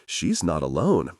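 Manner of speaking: ordinary delivery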